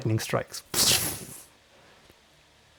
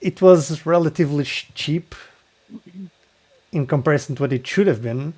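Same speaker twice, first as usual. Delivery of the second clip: game master narration. Acted. Game master setting the scene for the player.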